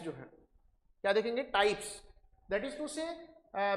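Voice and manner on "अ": usual speaking voice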